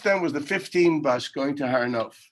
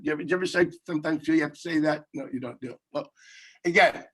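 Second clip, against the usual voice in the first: usual speaking voice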